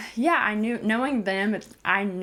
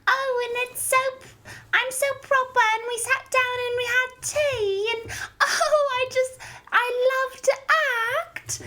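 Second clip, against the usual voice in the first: mock posh British accent, much higher; giggly high-pitched British impression; 3 dB louder than usual. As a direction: imitate a dainty British actress, high and proper. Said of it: "whenever she speaks in her little British accent".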